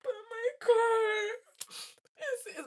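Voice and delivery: very high, loud, slow wail; bawling. Retold or imitated. Teen leaving her new car on the highway, wailing. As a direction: wail over the car as if heartbroken. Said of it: "I'm bawling my eyes out".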